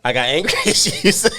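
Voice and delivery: a retort that breaks into laughter; laughing. Retold or imitated. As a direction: laugh through the punchline. Retelling arguing with therapist, laughing.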